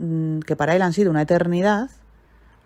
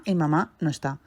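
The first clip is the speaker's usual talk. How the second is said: ordinary talk, nothing marked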